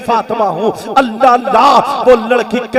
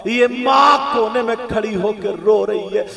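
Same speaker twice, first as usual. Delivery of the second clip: ordinary talk, nothing marked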